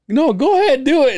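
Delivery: high, loud, laughing permission; wife's reply. Retold or imitated. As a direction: egg her on with a giggle. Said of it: "But then Amy was like".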